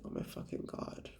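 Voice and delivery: whispered disbelief; exasperated whisper. Retold or imitated. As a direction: breathe it out in disbelief. Exasperated reaction to friend's message.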